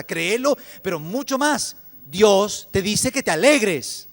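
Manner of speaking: building to a loud, high-pitched exhortation; emphatic proclamation. Real, not acted. Preacher urging listeners to believe God's word, loud proclamation. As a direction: proclaim it at full force, slowing on the good news.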